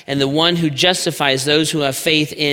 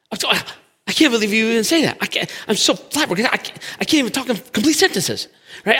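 high, fast, sputtering outrage; indignant churchgoer; 8 semitones higher, faster than usual. Acted. Pastor mocking an offended churchgoer, high huffy sputter.

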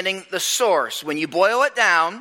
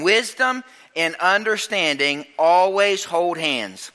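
slow emphasis; slower than usual. Shown as a demonstration. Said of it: "key maxim".

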